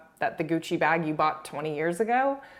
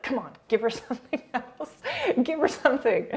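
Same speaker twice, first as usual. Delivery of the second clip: higher-pitched mock urging through a laugh; pleading look voiced; 8 semitones higher than usual. Voicing a castmate's look to Erica for help, mock pleading. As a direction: playfully beg for backup. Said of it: "she looks at Erica like".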